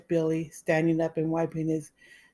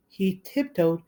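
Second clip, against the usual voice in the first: plain reading voice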